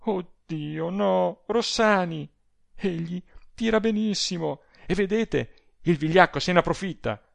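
high, distressed protest; a cry of alarm without much volume. A narrator's reading. Adele warns Rossani about his skilled opponent, crying out. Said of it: "gridò Adele".

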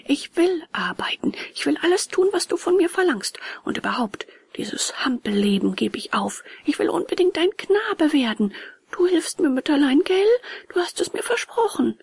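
put-on high, pleading child's voice; eager puppet boy. A narrator's reading. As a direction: promise mother everything, pitched high. Puppet boy promises his mother.

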